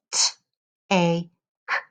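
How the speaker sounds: segmented robot voice, each sound apart; robot voice, sounds spaced out. Shown as a demonstration. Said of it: "when the teacher talks like a robot".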